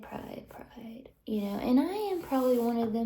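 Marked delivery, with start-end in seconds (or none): 0.0-1.1 fading whispered repeat; a soft echo of pride. Real, not acted. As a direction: let the word die away.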